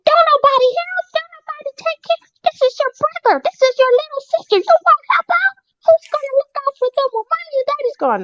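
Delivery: put-on high-pitched scolding scream at the kids; screaming rant. Nanny screaming at the kids about helping out. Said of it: "I'm just screaming".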